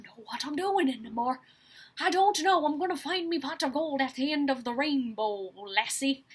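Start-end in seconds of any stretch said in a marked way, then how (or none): 2.0-6.2 light Irish leprechaun accent; a hint of a leprechaun brogue. Shown as a demonstration. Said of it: "weird voices like this".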